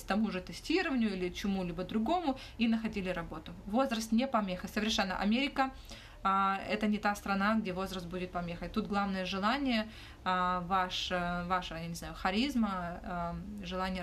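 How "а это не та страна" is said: normal talking voice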